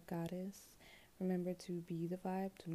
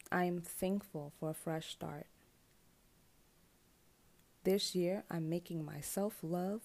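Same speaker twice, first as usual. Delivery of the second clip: affirmation reading; 3 dB louder than usual. Acted. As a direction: speak each affirmation like a vow. Reads affirmations slowly.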